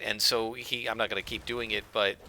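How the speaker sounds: ordinary delivery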